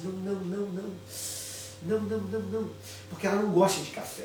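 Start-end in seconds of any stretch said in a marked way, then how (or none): 0.0-2.7 soft, hushed string of nos; quiet refusal. Retold or imitated. Wife quietly refuses coffee to her husband, softer. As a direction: refuse under your breath. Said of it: "olhou pra mim e falou assim".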